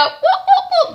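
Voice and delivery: high squealed whoop whoop; playful high cheer; mock cheer. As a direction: cheer it like a proud aunt. Cheering the second debutante.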